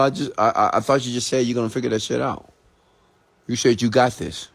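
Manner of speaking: normal talking voice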